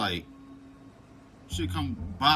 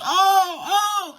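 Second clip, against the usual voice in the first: 1 mock crying scream; 7 dB louder than usual. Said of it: "and I'm screaming".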